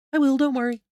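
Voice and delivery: high-voiced character reassuring a parent; bright-pitched promise to help; high reassurance. Character reassures departing father.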